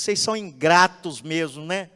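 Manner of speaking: loud, high reproach of the ungrateful people; the leader Moses did not become, shouting insults; angry scolding. Pretend. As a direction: berate them furiously. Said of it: "Ele não disse".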